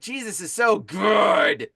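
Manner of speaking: slow, drawn-out weird enthusiasm; weirdly overjoyed. Retold or imitated. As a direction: stretch the praise out oddly, imitating him. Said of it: "that's a weird, weird response".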